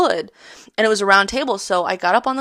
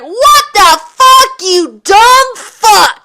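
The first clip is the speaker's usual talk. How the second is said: high, loud, slow shouted scold; mock angry yell; 13 semitones higher, 9 dB louder, much slower than usual. Imitating her mom yelling at her in the glass. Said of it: "she's like".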